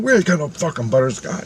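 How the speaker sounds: acted-out annoyed ice cream man; swearing vendor. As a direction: repeat the cursing man's complaint. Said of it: "he's swearing at me".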